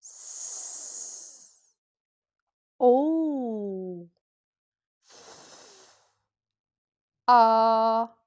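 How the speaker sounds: long voiceless S and F, held O and A; sound by sound. Shown as a demonstration. Teacher repeating for finger counting, sound by sound. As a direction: draw out S, O, F, A with pauses. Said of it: "Je répète".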